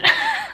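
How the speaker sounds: quick high giggle; a little laugh at yourself; short laugh. Real, not acted. Can't decide on a French, giggles at herself.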